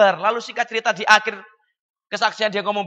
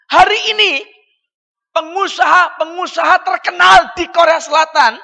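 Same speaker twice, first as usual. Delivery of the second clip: much higher, loud, slow proclamation; triumphant shout; 8 semitones higher, 9 dB louder than usual. Acted. Preacher proclaiming Korean farmers became tycoons, triumphant shout. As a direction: proclaim it like a victory. Preacher climaxing testimony point.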